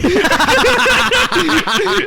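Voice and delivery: real high-pitched burst of laughter; loud high laughter. Real, not acted. Cracking up over the chutney story, loud high laugh.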